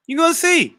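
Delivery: high teasing singsong; high playful taunt. Real, not acted. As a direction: pop it up high and mock him. Teasing a chat member.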